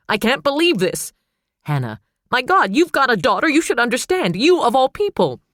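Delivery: high, loud furious outburst; hysterical. A narrator's reading. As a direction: rail at him.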